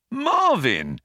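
high-pitched cry of surprise; startled exclamation. A narrator's reading. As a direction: call out the name in astonishment. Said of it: "he exclaimed".

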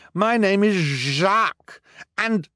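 high, slow pleading; desperate plea. Acted. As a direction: beg to be heard. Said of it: "the man cried".

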